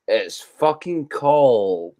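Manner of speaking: slow Scottish swearing about the cold; Scottish "cold", long and drawn out; Scottish pronunciation. Shown as a demonstration. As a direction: draw out cold in broad Scots. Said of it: "But me personally, I would say".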